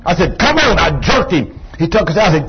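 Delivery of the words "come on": high-pitched retold command; forceful urging. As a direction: urge him on forcefully. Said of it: "you gotta be forceful sometime".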